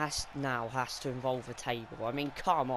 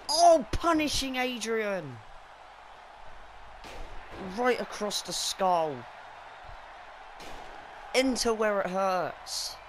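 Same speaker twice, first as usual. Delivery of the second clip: excited commentator; 6 semitones higher than usual. Real, not acted. Chair shots, commentator hyped.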